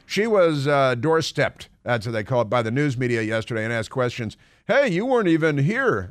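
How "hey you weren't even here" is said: lightly higher pushy reporter; teasing reporter jab; mock reporter. Reporter voiced doorstepping senator.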